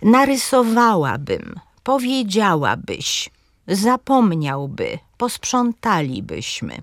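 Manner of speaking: powolne, staranne przykłady „Narysowałabym, powiedziałabyś”, z mocnym WA i DZIA; akcent proparoksytoniczny. Shown as a demonstration. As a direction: akcentuj „NarysoWAłabym, powieDZIAłabyś, zaPOMniałby”, cząstki „by” słabe. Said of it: "akcent pada na trzecią sylabę". (wolno i wyraźnie „zapomniałby”, z akcentem na POM)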